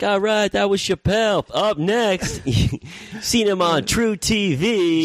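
high, slow, cheesy host announcement; comedy club emcee. Retold or imitated. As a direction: announce the next act like a cheesy MC. Mock emcee introducing next comic.

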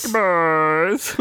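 high, drawn-out call held back in volume; half-hearted holler; restrained yell. Pretend. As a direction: yell it without getting too loud. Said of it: "I can't yell too loud".

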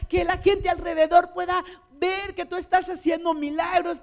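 loud, high, fervent prayer over music; fervent preaching prayer. Real, not acted. Preacher praying over the congregation, fervent and loud. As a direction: pray at full force, pleading.

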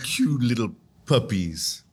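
mock-doting baby-talk voice; puppy baby talk. Host describing haters as cute puppies, doting baby talk. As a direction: dote on them like naughty little puppies.

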